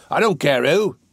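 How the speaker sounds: gruff, forceful dismissal; impatient. A narrator's reading. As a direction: cut him off impatiently. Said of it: "replied Sykes impatiently".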